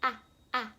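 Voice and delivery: "Ah, ah" short a demo; short A sound. Shown as a demonstration. Teacher sounds out the vowel for kids. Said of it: "short or long vowel sound".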